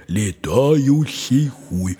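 медленно, нараспев «летающий хуй»; тянет каждый слог, как надпись на камне; торжественно читает надпись. Retold or imitated. (медленно, торжественно читает надпись)

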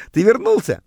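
mildly acted joy, voice lifted; high, pleased question to a returning friend; delighted surprise. A narrator's reading. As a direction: glad to see an old buddy back. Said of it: "обрадовался я".

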